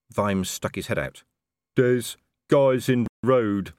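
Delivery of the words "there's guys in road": slow, loud, dim troll. A narrator's reading. Detritus warning Vimes about guards, slow troll voice. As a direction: speak slowly like a troll.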